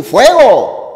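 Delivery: high, loud warning shout; urgent alarm yell; shouted order. Shown as a demonstration. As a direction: yell "¡Fuego!" loud as a command to get out. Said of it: "está dando una orden".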